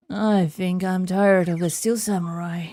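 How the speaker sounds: usual speaking voice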